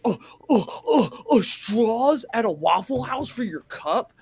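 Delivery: high, whiny, slow mock outrage over straws; mock outraged worker. Mocking a worker aghast at a straw request, whiny and high. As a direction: whine like the request is unbearable. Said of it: "treating you like a Karen".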